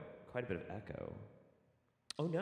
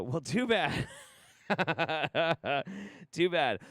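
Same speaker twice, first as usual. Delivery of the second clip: teasing; 5 semitones higher, 4 dB louder than usual. Acted. Teasing viewers.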